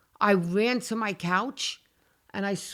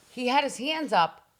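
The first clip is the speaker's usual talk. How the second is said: normal talking voice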